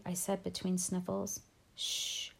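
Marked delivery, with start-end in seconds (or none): 1.7-2.3 unvoiced hush; quiet whispered shush; soft shush. A narrator's reading. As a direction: hush a crying child gently. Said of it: "she said".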